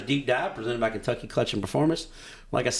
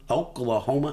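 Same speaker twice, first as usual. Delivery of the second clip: drawled Oklahoma with a put-on okie twang; okie accent. Shown as a demonstration. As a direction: say Oklahoma in a lazy okie accent, rising at the end. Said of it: "I'm gonna try this again".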